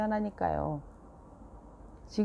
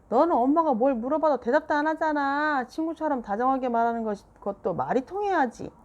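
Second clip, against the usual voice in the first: higher, louder exasperated mom; heated retort; 7 semitones higher, 5 dB louder than usual. A narrator's reading. Mom arguing back at Seoyeon, heated.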